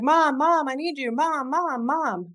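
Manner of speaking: mock little girl screaming for her mother; child calling mom. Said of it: "she's screaming like".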